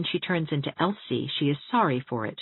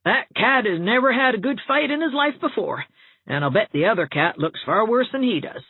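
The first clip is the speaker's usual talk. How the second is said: put-on loud, gleeful old housekeeper's crow of triumph; exultant Rebecca Dew; 6 semitones higher, 4 dB louder than usual. A narrator's reading. Rebecca Dew gloating to the widows over the battered cat, loud and exultant. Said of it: "Rebecca Dew said exultantly".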